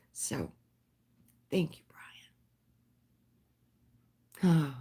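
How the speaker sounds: a hushed, emotional thank-you; soft whisper. Real, not acted. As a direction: breathe the thanks out barely voiced.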